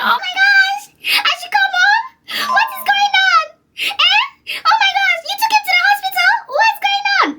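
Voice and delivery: very high, crying faked alarm; faked panic. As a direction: play up shock at bad news. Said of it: "I was like".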